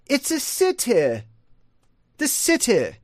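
high-pitched "city" said sih-tih; "city" as sih-tih, demonstrated high; short-I pronunciation. Shown as a demonstration. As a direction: hit a crisp T and end "city" on a short I. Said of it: "two short I's".

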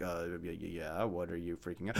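low, quiet, underplayed response; unbothered reply. Bystander answering the one freaking out, unbothered. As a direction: answer the drama in a subdued low voice.

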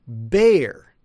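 "bear" with long A and full R; demonstrated American "bear"; American pronunciation. Shown as a demonstration. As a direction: draw out "bear" as bay-er, American R. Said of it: "American pronunciation, we'd say".

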